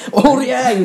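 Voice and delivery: high yell with a laugh in it; battle cry. Acted. Replaying his three-second knockout, laughing battle cry. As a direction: yell a comic charge, half laughing. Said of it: "でっかい声で".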